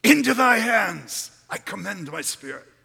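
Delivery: a loud dying cry to heaven; big voice. Acted. Jesus calling to the Father from the cross, loud. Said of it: "He died with a big voice".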